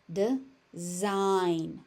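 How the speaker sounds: "Design" split as de... zign; syllable by syllable. Shown as a demonstration. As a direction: pause between the halves of "Design". Teacher breaks word into syllables.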